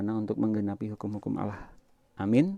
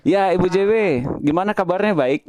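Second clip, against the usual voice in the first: speaking up loud; 6 semitones higher, 6 dB louder than usual. Real, not acted. Speaking up over a broken phone connection.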